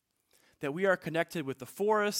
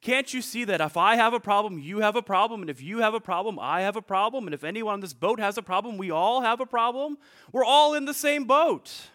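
higher and louder, climbing to a frantic cry; panicked prince pleading, rising to a shout; full-on panic; 4 semitones higher, 6 dB louder than usual. Acted. Prince pleads with the boatmaker, frantic and loud. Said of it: "Now the prince was in a full-on panic".

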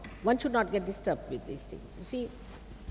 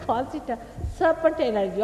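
usual speaking voice

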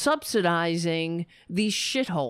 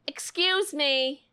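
snide excuse me; 5 semitones higher, slower than usual. Retold or imitated. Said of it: "And you're like".

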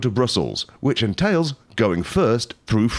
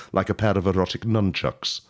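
normal talking voice

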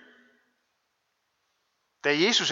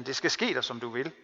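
Jesus's words, softer and gentle; tender; 5 semitones lower, 5 dB quieter, faster than usual. Retold or imitated. Preacher voicing Jesus to the woman, softly tender. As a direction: speak it tenderly, as a blessing.

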